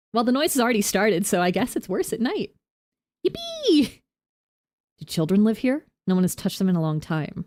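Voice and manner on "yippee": high squeaky yippee; sarcastic little cheer, pitched way up; hammed-up fake delight; mock cheer. Mock cheer at scary noise.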